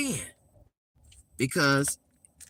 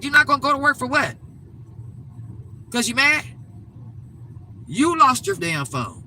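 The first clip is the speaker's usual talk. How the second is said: exasperated scolding; 7 semitones higher than usual. Retold or imitated. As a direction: demand he make it make sense. Mother scolding grown son.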